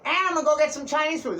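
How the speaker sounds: sing-song parody of a clueless cat owner; mocking clueless guy. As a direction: mimic the guy's dumb plan, breezy and quick. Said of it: "You thought it was a good idea".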